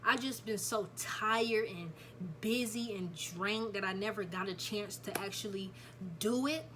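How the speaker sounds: ordinary talk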